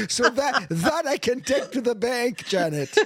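amused, high and drawn out; laughing. Real, not acted. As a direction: say it through the tail of a laugh. Joking, still laughing with host.

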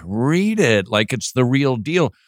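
ordinary talk, nothing marked

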